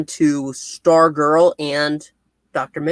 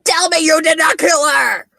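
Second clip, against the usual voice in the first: much higher, loud shouted plea; mock desperate yell; 13 semitones higher, 3 dB louder than usual. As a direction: join in with a hammed-up shout. Co-host joins acting Rick's yell.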